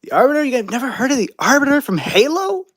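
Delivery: high, loud, disbelieving question; incredulous. Real, not acted. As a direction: ask it aghast, pitch shooting up at the end. Shocked fans don't know Arbiter.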